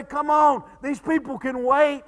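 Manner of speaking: a frantic father pleading for his dying son; desperate father. Acted. Desperate father begs Jesus.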